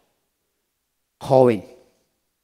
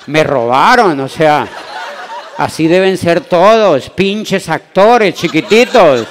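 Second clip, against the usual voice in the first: mock outrage; 3 semitones higher, 8 dB louder, slower than usual. Real, not acted. Ranting about short actors.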